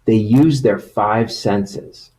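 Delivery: storybook reading voice; reading to children. Acted. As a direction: read it like a picture book to kids. Reads picture book to children.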